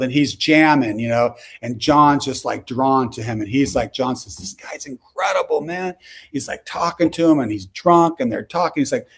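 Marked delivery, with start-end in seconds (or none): 4.4-6.0 soft, high imitated English accent. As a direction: quote him in a hushed, higher English accent. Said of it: "kind of an English accent".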